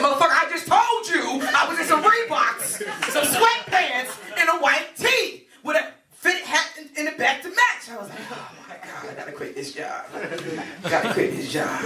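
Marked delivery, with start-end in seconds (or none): 0.0-7.9 high, exasperated mock yell; irate caller. Caller repeating himself to customer service, exasperated. 8.3-11.8 low, very soft aside to himself; defeated muttering. Retold or imitated. Customer service worker after a stupid caller, quietly defeated.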